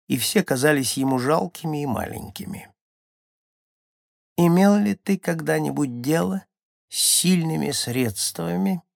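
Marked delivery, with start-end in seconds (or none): 4.4-8.8 high, thin questioning voice; Скунду. A narrator's reading. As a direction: ask it high, as a small shaman. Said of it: "тонкий голосок которого был похож на слабый". (тонкий высокий голосок)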